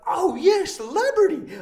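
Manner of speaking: high, loud, over-excited fan shout; loud hammed-up celebrity spotting; imitating starstruck strangers. Voicing excited passersby crowding him.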